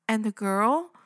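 ordinary talk, nothing marked

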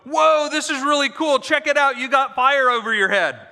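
mock amazement. Mocking believers gawking at fire over their heads. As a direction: play the gawking onlooker, wide-eyed. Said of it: "They didn't hunker down inside and go".

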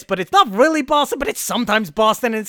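loud, high, hammed-up Boston-ish New York voice; big broad American accent, part Boston, part New York; botched Boston accent. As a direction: belt it out in a wandering Boston accent. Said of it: "he kinda talks like this".

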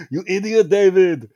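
high, very loud hammed-up insult; mock angry yell. Podcast host scolding their critic David, mock angry yell. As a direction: yell it in exaggerated exasperation. Acted insult at the letter writer.

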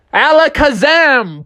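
high, drawn-out incantation; magic spell. Acted. Fish wizard grants the bear's wish, high drawn-out spell. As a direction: cast it big and slow. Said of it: "and he said".

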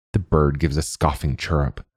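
ordinary delivery

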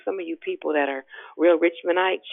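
ordinary talk

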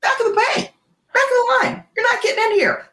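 high, mocking brush-off of the robber; imitating a casual brush-off; dismissive brush-off. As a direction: wave him off like a line-cutter. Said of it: "We're just like".

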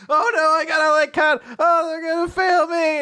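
high, loud wail of dread; hammed-up exam meltdown; mock panic. Retold girl panics about exam.

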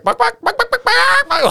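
very high squawking chicken clucks. Retold or imitated. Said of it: "he's just like".

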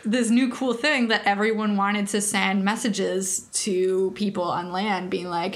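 ordinary talk, nothing marked

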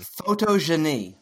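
PHOTOGENY said French-style, foh-toh-zhuh-nee; Photogeny drawn out as a fancy French term, zh for g; fancy French pronunciation. Shown as a demonstration. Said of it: "say the fancy term again".

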